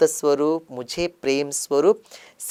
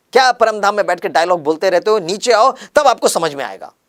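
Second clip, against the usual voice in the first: louder, exasperated jab at Baba; annoyed outburst; 4 semitones higher, 6 dB louder than usual. Retold or imitated. Frustrated devotee scolds Baba for preaching from afar, louder. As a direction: complain in mock frustration. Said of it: "मुझे ना बाबा पर ही गुस्सा आया".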